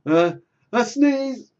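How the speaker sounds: building gasps then a loud, high yelled "a sneeze"; fake sneeze shout. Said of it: "I was like".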